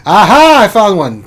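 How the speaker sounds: high, loud "aha" of triumph; triumphant discovery. Real, not acted. Streamer searching finally finds one, triumphant shout. Exclaims on finding a candidate.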